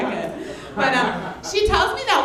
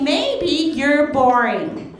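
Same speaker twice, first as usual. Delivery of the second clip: slow deadpan; 2 semitones higher, much slower than usual. Retold or imitated.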